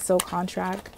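ordinary delivery

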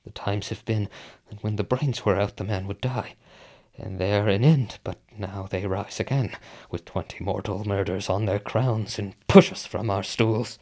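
1 hushed dread. Acted. Shaken Macbeth muses on the dead.

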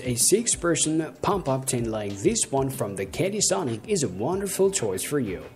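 normal talking voice

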